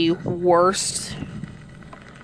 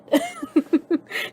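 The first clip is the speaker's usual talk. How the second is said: laugh. Real, not acted. Laughing at the woman.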